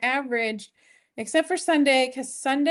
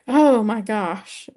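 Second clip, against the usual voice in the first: exasperated; 7 dB louder, slower than usual. Real, not acted. Cat karate chopping.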